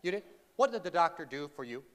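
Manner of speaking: ordinary talk, nothing marked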